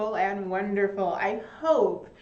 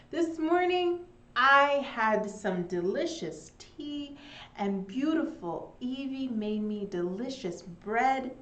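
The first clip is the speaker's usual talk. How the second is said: ordinary talk, nothing marked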